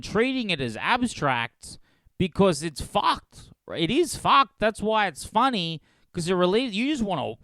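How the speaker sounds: high, loud exasperated rant; outraged rant. Real, not acted. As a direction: rail at the hypocrisy. Ranting at hypocrites.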